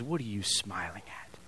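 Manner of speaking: hissed whisper of a sneering demon; imp whisper. Acted. Preacher voicing the imp taunting the angel, whispered.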